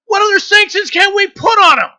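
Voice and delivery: high, loud exasperated shout; real exasperation, shouted. Real, not acted. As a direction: yell the question in disbelief. Exasperated rant about sanctions.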